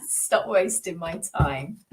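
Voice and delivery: slow fed-up. Acted. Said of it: "It's like".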